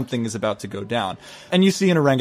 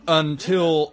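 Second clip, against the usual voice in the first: ordinary delivery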